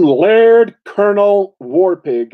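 host announcing his future lordly title; pompous title. Pretend. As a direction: savour the title like a self-crowned lord. Announcing his own grand title.

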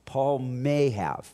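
ordinary talk, nothing marked

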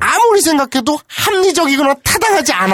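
high, loud shout; hammed-up indignant protest; acted exasperation. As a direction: yell it out in comic bewilderment. Acts out the bewildered boy's protest.

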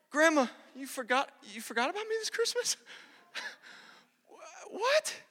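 hammed-up high, small voice of a hurt child; mock tearful disbelief. Forgotten grandson questioning grandma, mock tearful disbelief. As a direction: squeak it out in mock heartbreak. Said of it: "I'm crying, she's crying".

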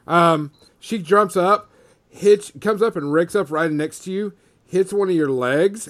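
loud, quick combat call; excited narration. A narrator's reading. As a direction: sell the hit with energy.

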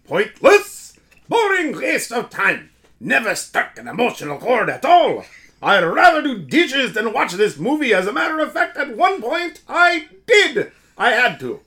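loud, high mock German accent reading a movie review. Cohost reads a viewer's review as a German. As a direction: shout it out in a hammed-up German accent. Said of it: "you should read this in a German accent".

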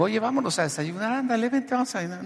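high-pitched mock pleading to come along; acted coaxing. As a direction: coax them away in a high, put-on voice. Acted coaxing to leave for breakfast.

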